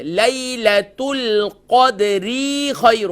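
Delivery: slow drawn-out tajweed chant; Quran recitation. Shown as a demonstration. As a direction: chant it slowly, stretching the vowels. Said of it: "ہم پڑھتے ہیں". (دھیمی تلاوت)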